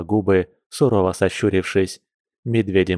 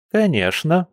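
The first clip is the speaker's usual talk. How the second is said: put-on high-pitched old granny voice; agreeing old woman; 7 semitones higher than usual. A narrator's reading. Old woman agreeing with Dima, high granny voice. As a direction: chime in as a little old lady. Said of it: "поддакнула старушка".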